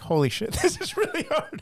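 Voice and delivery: laughing disbelief. Retold or imitated. Guitarist to podcast hosts, laughing in awe. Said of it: "And I was like".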